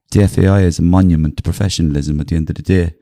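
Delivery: loud, deadpan footballer impression; mock-solemn statement. Comic tries to make the footballer laugh, loud deadpan impression. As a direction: declare nonsense with full gravity. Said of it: "I'll say something ridiculous".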